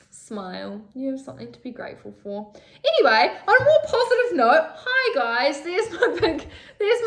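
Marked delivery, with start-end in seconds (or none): 2.8-7.1 high, loud laughing through the words; higher, louder laughing relief. Real, not acted. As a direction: laugh it off after the tears. Laughs after crying rant.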